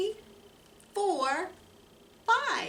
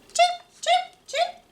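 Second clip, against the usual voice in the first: high, sharp chip chip chirps; chirping bird; 5 dB louder than usual. Acted. Reading the bird's chirps to children, high and sharp.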